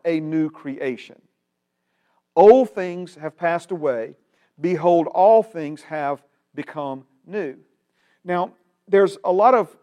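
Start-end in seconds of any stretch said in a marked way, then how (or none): 2.3-7.6 scripture proclamation. Acted. Preacher proclaims the verse to his class, loud and ringing. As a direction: proclaim it to the room.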